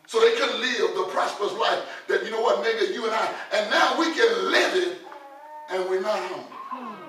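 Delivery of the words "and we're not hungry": normal talking voice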